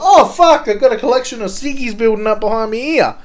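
high, loud startled cry, then fast; surprised exclamation. Retold or imitated. Mate mimicking his stoned friend, startled and fast. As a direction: blurt it out in mock shock. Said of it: "He's like".

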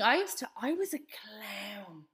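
slow whispered self-mocking aside. Real, not acted. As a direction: whisper it slowly, embarrassed.

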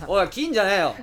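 loud laughing call; shouting through laughter; after laughing hard. Retold or imitated. Voicing laughing caller.

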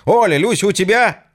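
loud high call over static; shout down the line. Acted. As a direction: yell the question into the receiver. Shouting on bad phone line. (громкий крик в трубку)

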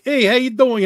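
loud, high mock greeting; calling out greeting. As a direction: holler a hello into the room. Quotes own shouted greeting at audition.

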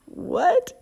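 high, rising puzzled question; bewildered high-pitched query; baffled. Real, not acted. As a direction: squeak it out in disbelief. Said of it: "I don't even see the connection".

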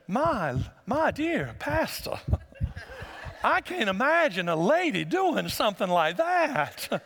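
a hint of a Southern belle accent, slow and genteel. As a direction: lightly play a prim Southern lady. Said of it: "fancied themselves as Southern belles".

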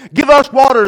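loud, high cry of complaint; wailing demand. A narrator's reading. Thirsty people to Moses, loud wail. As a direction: wail it out as a crowd. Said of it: "they wailed".

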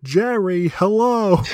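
very loud, high phone holler; Metatron robot voice. Acted. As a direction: join the bit, booming high like a clueless robot. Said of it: "robot voice just being like".

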